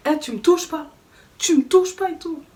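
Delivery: high, loud angry yelling, building; retold angry yell. Shoved out the door, she yells back at him. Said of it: "je me suis énervée".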